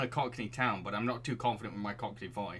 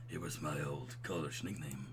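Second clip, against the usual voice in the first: low, soft bro voice; deep, mellow and easy; smooth and buttery; 8 semitones lower, 9 dB quieter than usual. Acted. Old roommate explaining his nickname, smooth low voice. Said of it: "I can do smooth and buttery".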